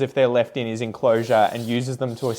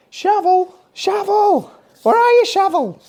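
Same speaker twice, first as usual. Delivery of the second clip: high, loud mock dog call; 9 dB louder, slower than usual. Owner to an imagined dog named Shovel, calling out. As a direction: holler playfully for a runaway dog.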